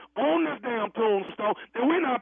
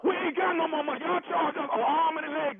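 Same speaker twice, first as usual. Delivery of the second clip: angry shout; 4 semitones higher than usual. Acted. Customer yells at the funeral director about extra charges, shouting. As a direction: yell that you're broke. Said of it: "if you keep yelling".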